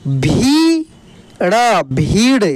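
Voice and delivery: long भी, pause, ड़, then slow भीड़; drawn-out spelling out. Shown as a demonstration. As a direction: sound out भी ड़ and blend into a stretched भीड़. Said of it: "खींच कर किया जाता है". (भी ड़ भीड़, खींच कर)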